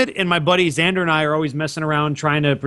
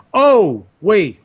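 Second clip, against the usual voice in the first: ordinary delivery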